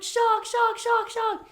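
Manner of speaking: high, loud, frantic screaming; panicked shark cry. Retold or imitated. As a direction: scream a warning in terror. Retold panic calling shark.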